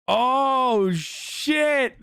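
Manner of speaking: surprised yelp. Real, not acted. Excited reaction to a chat message.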